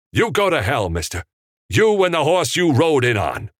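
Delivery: loud, high-pitched furious send-off; acted outburst, pitch way up; angry cursing. A narrator's reading. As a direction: hurl the insult at him. Director curses his opponent.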